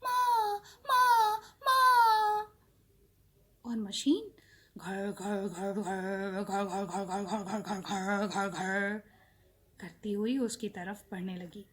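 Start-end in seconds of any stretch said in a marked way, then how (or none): 0.0-2.6 very slow, high, loud drawn-out cries; loud calling. A narrator's reading. Baby bird calling out loudly to the boat. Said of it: "जोर से पुकारता हुआ". 4.8-9.0 machine rumble. A narrator's reading. Crane noise.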